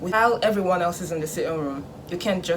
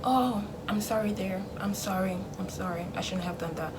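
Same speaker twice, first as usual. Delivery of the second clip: mock apology; 8 dB quieter than usual. Parent apologizing for punishing you, soft and make-believe. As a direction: murmur a parent's imagined apology. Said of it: "if you're waiting for an apology".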